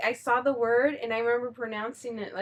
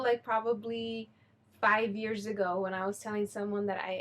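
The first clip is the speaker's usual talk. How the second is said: normal talking voice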